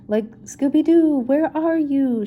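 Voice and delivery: Shaggy searching for his buddy, calling loud. Acted. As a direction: call out across the dugout. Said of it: "Shaggy called".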